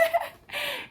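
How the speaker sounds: high-pitched burst of laughter. Real, not acted. She laughs at her own boast in the store, high laugh. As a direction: laugh at your own nerve.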